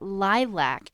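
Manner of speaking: lilac drawn out with a hard "lack"; ugly-sounding pronunciation. Shown as a demonstration. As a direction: say lilac slowly as "lie-LACK". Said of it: "uh, sound for such a pretty".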